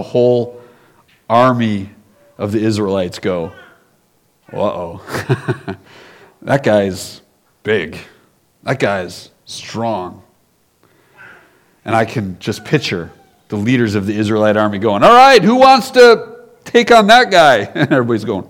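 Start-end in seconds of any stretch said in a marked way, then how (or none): 4.5-10.2 mock-scared and hushed, slow; a nervous soldier muttering, low and quiet; scared whisper-quiet. Scared Israelites voiced. 15.0-17.6 higher, louder leader's call; rally the troops, asking loudly; army leader calling out. Acted.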